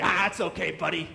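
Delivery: loud matey back-slap; loud, chummy pal voice; buddy-buddy. Retold or imitated. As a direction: brush it off like an old pal, loudly. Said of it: "put Peter in a headlock and say".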